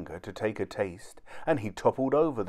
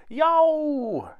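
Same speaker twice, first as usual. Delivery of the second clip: high, loud drawn-out yelp; pained yell; 6 dB louder than usual. A narrator's reading. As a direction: yell out as if scalded by hot water. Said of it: "yelled Tom".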